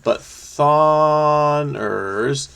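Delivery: very slow, stretched-out syllables; long held vowels, mulling it over; puzzling it out. Real, not acted. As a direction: draw the word out while thinking. Puzzling over the word's ending.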